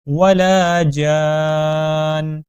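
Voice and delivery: slow melodic tajweed chant; Quran recitation. Shown as a demonstration. Recitation demo.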